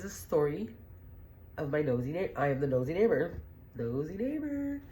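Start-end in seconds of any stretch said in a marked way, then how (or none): 3.8-4.8 much higher, slow sing-song; host sings the segment title; playful catchphrase. Acted. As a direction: sing out the show segment's name. Sign-off catchphrase.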